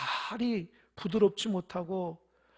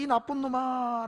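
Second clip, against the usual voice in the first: echo from opposite side; 4 semitones higher, slower than usual. A narrator's reading. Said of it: "반대편 똑같이".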